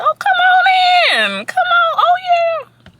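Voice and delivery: high, loud, slow Southern welcome call. Retold or imitated. Cheery Southern host calling a visitor inside. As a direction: call out a big Southern come-on-in. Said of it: "The southern cities that I go to".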